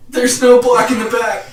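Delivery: mock cracking high voice, scared ref; high, strained ref announcing a penalty. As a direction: imitate a ref whose voice cracks in fear. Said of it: "his voice cracks".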